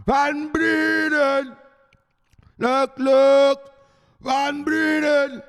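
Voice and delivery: high, loud, drawn-out holler; hammed-up high-pitched shouting; Van Breden yell. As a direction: stretch the name into a high yell. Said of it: "Even the Van Breden yells".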